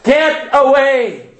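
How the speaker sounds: slow, forceful order; authoritative command. Acted. Preacher shows how to send a dog off, authoritative command. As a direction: drive it off with drawn-out authority. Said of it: "that dog knows an authoritative voice".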